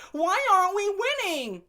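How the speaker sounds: hammed-up complaining teammate; squeaky mock whine; mock whiny girl. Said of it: "and, like".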